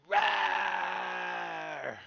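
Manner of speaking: loud high battle roar; roaring charge. Acted.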